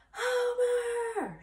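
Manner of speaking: high, loud call across the farm; cry for Homer. Acted. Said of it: "she cried".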